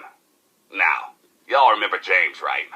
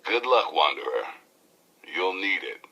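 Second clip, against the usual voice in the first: ordinary delivery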